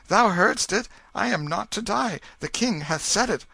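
put-on boy telling the lords he lives; joyful exclaim. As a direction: announce your reprieve gleefully. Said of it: "turned a joyful face".

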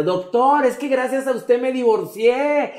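high, loud, gushing call; fan shouting across the plane. Retold or imitated. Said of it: "remata con la tercera". (grito de fan emocionada, agudo y fuerte)

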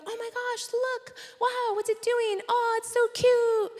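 high, breathy-free gushing coo; high excited coo at a pigeon; cooing diners. Retold or imitated. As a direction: gush over the cute bird like a crowd of onlookers.

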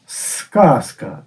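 the Russian word сказка, said with a soft Russian k; Russian pronunciation. Shown as a demonstration. Teacher contrasting the Ukrainian word with the Russian сказка. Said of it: "Росіяни причепили спереду суфікс".

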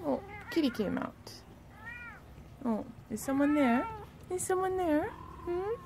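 high, sweet voice for the cat; cat talk. Real, not acted. Spotting the cat, calling to it.

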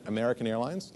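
ordinary talk

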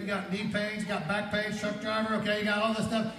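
high, building to loud preaching; fervent preaching. Real, not acted. Preacher to congregation on pain being gone, fervent preaching. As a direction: drive it up to a shouted release.

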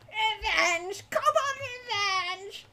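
very high, drawn-out creature screech; cackling kobold. A narrator's reading. Captive kobold gloating to captors, laughing shriek. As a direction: crow it with choking, laughing glee. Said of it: "began to laugh".

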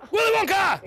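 high-pitched scream on cue; group scream. Pretend. Streamer leading other players in a countdown chant, screaming. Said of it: "we're gonna scream Willy Wonka".

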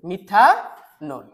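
Methanol split as মিথা, long pause, নল; slow methanol, rising on মিথা before the gap; broken into parts. Shown as a demonstration. Teacher giving students an example to write, slowly broken up.

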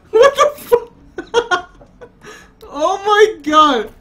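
high, loud laughing disbelief; crying with laughter; gasping out the words through laughter; laughing hard. Real, not acted. Laughing fit at game.

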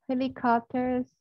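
"helicopters" slowly, the final r-sound made with the tongue curled up; slow "helicopters" with a curled American r; curled-tongue ending. Shown as a demonstration. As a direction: stretch "helicopters" and curl the tongue at the end. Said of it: "lưỡi hơi cong lên tí nhá".

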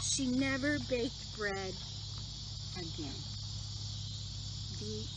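gentle storybook reading voice; reading a story to children. Acted. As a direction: read it like bedtime story time.

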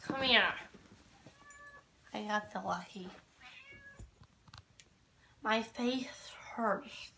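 ordinary delivery

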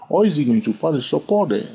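ordinary delivery

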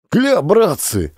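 high, slow, excited calling out; crowd shouting. A narrator's reading. A man in the crowd shouts, recognizing the commandant. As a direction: call out in recognition. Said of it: "заорали в толпе".

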